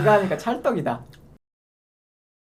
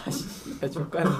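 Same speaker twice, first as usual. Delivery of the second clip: cursing under breath; 2 semitones lower, 8 dB quieter than usual. Real, not acted. Muttered curse after annoyance.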